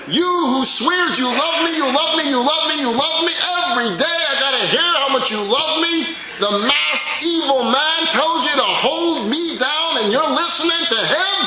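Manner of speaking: put-on high, loud, hurt child's voice; betrayed child. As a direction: cry out like a betrayed little child. Betrayed child confronts mother.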